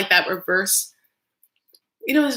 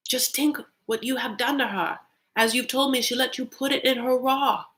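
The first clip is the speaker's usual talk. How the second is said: higher put-on Nigerian accent; brother's accent; 6 semitones higher than usual. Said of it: "the brother's just like".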